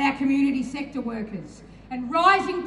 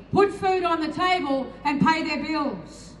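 ordinary talk

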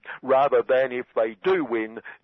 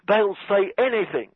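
a light mock Trump, pitched up; Donald Trump impression; 7 semitones higher than usual. Satirist quoting Donald on his opponents, light mock scoff. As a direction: dismiss the critics with a scoff. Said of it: "this is Donald speaking".

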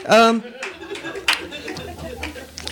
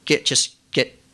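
normal talking voice

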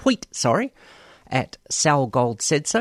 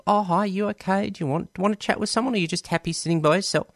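higher, quicker, friendly asking voice; friendly check-in; 8 semitones higher, faster than usual. Retold or imitated. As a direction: brightly check on someone sitting alone. Voicing friendly people at swim night.

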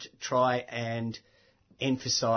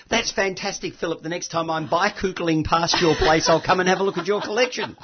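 higher, louder gushing quote; retold enthusiasm; 5 semitones higher, 6 dB louder than usual. Audience member calling to the speaker, enthusiastic. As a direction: call it out brightly from the audience.

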